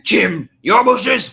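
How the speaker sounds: high, loud, drawn-out yell; acted shout. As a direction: call it out loud and slow. Blurts out an acted quote.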